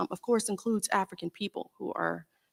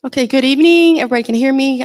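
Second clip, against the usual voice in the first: booming, much louder opening to the room; loud greeting; 4 semitones higher, 13 dB louder, faster than usual. Real, not acted. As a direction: project the greeting to the back of the hall. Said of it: "I'm a little bit loud".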